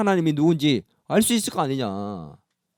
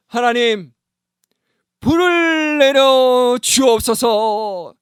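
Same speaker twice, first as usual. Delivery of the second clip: higher, slow drawn-out plea to God; solemn prayer; 9 semitones higher, 7 dB louder, much slower than usual. Retold or imitated. Elijah calling down fire, solemn and slow. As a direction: pray it long and grand.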